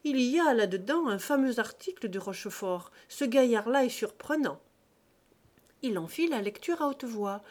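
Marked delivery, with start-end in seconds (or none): 0.0-4.6 loud, eager outburst; an enthusiastic reader calling out his praise; excited exclaim. Acted. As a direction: gush over the article. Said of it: "Il s'écria".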